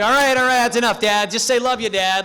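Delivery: high, loud taunting shout; mock shout. Teen taunting a dad across the meeting room, loud mock shout. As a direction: yell it back to egg the dad on.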